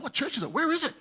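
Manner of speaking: high, eager barrage of questions; rattled-off questions. Retold or imitated. Young shop worker quizzing a pastor, imitated as rattling off questions. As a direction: imitate someone firing question after question. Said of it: "he's just rattling off all these questions".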